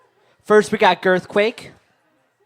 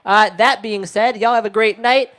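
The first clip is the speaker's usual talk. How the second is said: ordinary delivery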